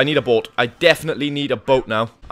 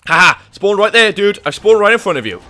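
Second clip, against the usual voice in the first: loud, gleeful taunting; loud gleeful taunt at the enemy; gloating laugh; 6 semitones higher, 8 dB louder than usual. Real, not acted. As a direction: crow at the enemy you surprised. Gloating at enemy after respawn.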